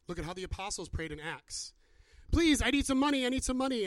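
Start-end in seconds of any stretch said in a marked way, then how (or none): 2.3-3.9 high-pitched beggar's plea; urgent, raised-pitch begging for coins. Acted. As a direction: plead like a beggar at the gate. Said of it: "the beggar at the gate".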